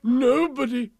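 high, loud, grand declaration; big happy royal exclamation; elated king. A narrator's reading. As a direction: proclaim it fondly, restored to good cheer. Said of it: "as he kissed her tenderly".

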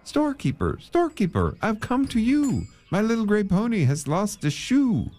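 high-pitched storybook plea; pleading caller. A narrator's reading. Man asks storekeeper for coal, high pleading call. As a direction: call out high and appeal for help.

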